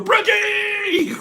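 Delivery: "Bridgie!" yelled very high and drawn out; yelled name. Shown as a demonstration. Host showing viewers the right way, high drawn-out yell. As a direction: belt out "Bridgie!" long and high. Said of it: "You have to go".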